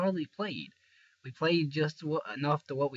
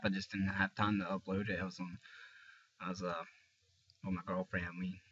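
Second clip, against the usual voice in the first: usual speaking voice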